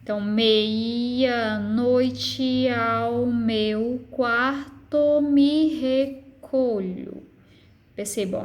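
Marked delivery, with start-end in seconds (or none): 0.2-7.2 very slow chanted scansion, every syllable stretched; escansão silábica. Shown as a demonstration. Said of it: "Aqui é pronunciada com força". (verso arrastado, sílaba por sílaba)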